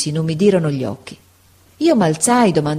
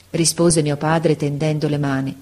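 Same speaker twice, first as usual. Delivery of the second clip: ordinary delivery, nothing marked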